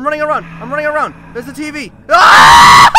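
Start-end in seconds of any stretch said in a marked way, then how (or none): 2.1-3.0 long, loud wavering scream; terrified scream. Real, not acted. Player caught by a scare, terrified scream.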